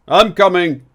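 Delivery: loud call toward the door; answering the knock with a loud call. Acted. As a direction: shout back to the visitor at the door.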